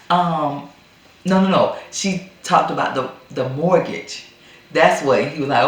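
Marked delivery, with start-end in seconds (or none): none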